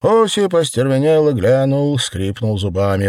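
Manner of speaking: ordinary delivery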